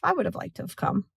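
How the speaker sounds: mock hurt friend, hinting you left her out; passive aggressive. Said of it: "start being passive aggressive".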